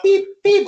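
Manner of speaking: loud, very high electronic beeps; piercing mimicked blips; imitated scanner beep. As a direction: squeak out two till beeps.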